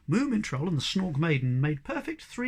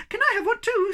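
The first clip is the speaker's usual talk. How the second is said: much higher, squeaky little voice; 5 dB louder than usual. Acted. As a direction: squeak it out eagerly. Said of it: "squeaked Sniff".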